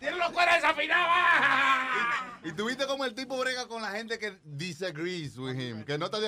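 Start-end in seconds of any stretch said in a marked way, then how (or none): 0.0-2.4 loud, high, hammed-up shouting; gritos de merengue. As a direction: yell it out like a rowdy merengue session. Imita gritos en grabación de merengue. (gritos exagerados, agudos y a todo pulmón)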